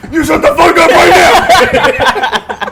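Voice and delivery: high, loud, slow laughing yell; laughing shout. Pretend. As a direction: shout it while cracking up.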